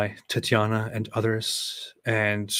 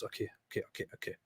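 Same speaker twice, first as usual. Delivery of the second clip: whispered-down pep talk to himself; quiet mock mutter reminding himself to be angry; mock self-reminder, softer; 7 dB quieter, much faster than usual. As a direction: coach yourself under your breath. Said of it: "But he thinks".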